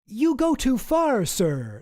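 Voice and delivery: mock outrage. Mock outraged quote of Jesse.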